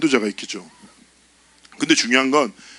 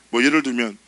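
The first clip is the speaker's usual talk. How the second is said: ordinary talk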